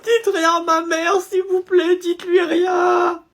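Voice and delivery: supplier d'une voix aiguë et cassée; suppliant en pleurs. Acted. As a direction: implorer la prof en larmes. Élève supplie en pleurant la prof. (supplication larmoyante)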